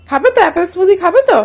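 ordinary talk

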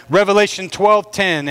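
normal talking voice

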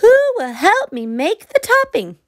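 high chirpy hen voice. A narrator's reading. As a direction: squeak it out like a little hen. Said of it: "she said".

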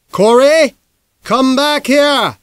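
loud, high, slow holler across the room. Acted. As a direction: holler after a fleeing boy. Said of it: "he hollered".

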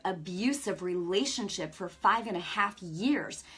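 usual speaking voice

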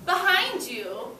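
put-on slow, bright read-aloud to children; story reading voice. A narrator's reading. Teacher reading a picture book to her class, slow and bright.

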